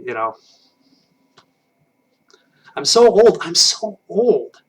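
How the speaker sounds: ordinary talk, nothing marked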